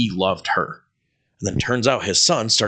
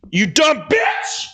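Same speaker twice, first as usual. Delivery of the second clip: mock yell; slower than usual. Pretending to yell at the coworker. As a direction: drag it out in a fake rage.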